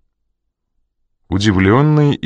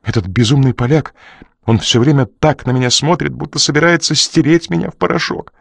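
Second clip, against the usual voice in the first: higher frightened question; fearful question; 6 semitones higher than usual. Acted. Crew asking Coridon if he is doomed, scared. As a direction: ask it like a scared man.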